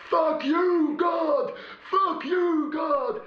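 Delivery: high, full-throated yelling at God; screaming curses, pitch way up; retold drunken shout. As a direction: bellow it at the sky like a raving fan. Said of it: "he was still going".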